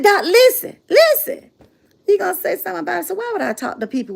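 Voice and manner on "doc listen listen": second listen jumps much higher, pleading; urgent plea. Retold or imitated. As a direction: beg her to listen, rising. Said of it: "She said".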